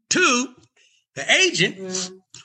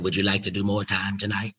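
lower, calm and in charge; low cool Katt Williams voice; 6 semitones lower, 4 dB quieter than usual. Retold or imitated. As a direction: ask like the man running the night. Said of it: "He said".

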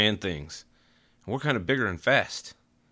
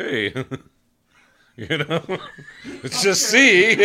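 laughing quote; 9 semitones higher, 7 dB louder, slower than usual. Retold or imitated. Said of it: "It's probably like".

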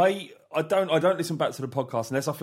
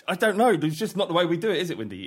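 normal talking voice

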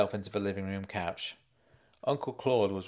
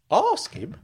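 startled echo; 12 semitones higher, 5 dB louder than usual. Acted. Uncle challenges Frank, "Ask him" high and startled. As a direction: ASK HIM pitched high, dropping at the end.

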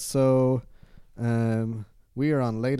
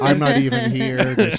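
laughing; 2 semitones higher, 9 dB louder than usual. Real, not acted. Joking, laughing loudly.

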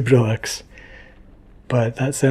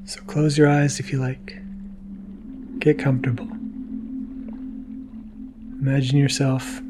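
guided relaxation; 4 dB quieter than usual. Acted. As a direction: ease them gently toward sleep. Sleepy guided wind-down to listeners.

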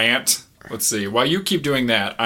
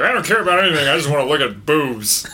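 mock reviewer voice; 3 semitones higher, 3 dB louder, faster than usual. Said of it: "I was like".

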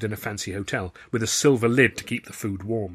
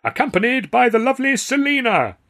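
announcer; 14 semitones higher, 7 dB louder than usual. A narrator's reading. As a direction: call it to the house grandly. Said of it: "to announce".

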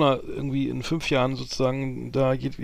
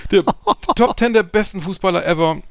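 higher, louder hyped re-announcement; excited announcement; 6 semitones higher, 7 dB louder than usual. Shown as a demonstration. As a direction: announce it like a big show. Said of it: "repeated louder with a laugh".

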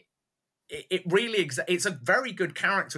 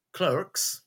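American accent; 4 semitones higher than usual. Shown as a demonstration. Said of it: "which I still cannot keep saying in an American accent".

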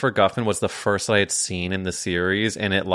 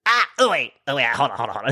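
put-on older woman failing to scream; 3 semitones higher, faster than usual. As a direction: imitate an older coworker backing out. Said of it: "tries to get a scream out".

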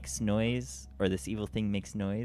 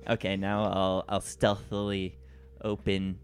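normal talking voice

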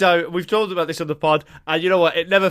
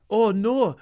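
put-on Welsh accent; 5 semitones higher than usual. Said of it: "all of our impressions start with us going".

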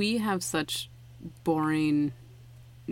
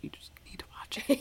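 whispered urging; 8 semitones higher, 5 dB quieter than usual. Retold or imitated. Friends urging her to watch.

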